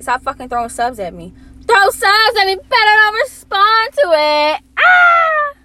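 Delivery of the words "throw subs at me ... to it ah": high, drawn-out belted yell; loud wailing outburst. Pretend. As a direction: belt it out high and loud, stretching the words. Playful outburst after complaint about subs.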